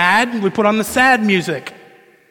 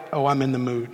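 low, soft murmur to oneself; hushed, sinking aside in an imitated voice; in the mood; 6 semitones lower, 7 dB quieter, faster than usual. Woman hearing her cry song, low and indulgent.

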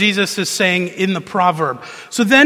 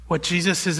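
usual speaking voice

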